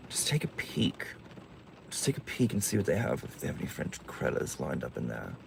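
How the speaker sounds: unvoiced, hushed, sneaking words; whispered aside. Real, not acted. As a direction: whisper it like you're slipping away unseen. Sneaking off quietly.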